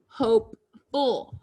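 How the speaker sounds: HOPEFUL slowly, with a break between hope and ful; split into word parts. Shown as a demonstration. Word built from base plus suffix.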